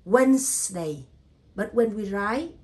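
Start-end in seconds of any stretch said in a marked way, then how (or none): 0.1-1.0 "Wednesday" as two syllables, WENS-day, firm and loud; silent D and E. Shown as a demonstration. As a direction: leave the middle D and E silent in "Wednesday". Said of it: "So we say".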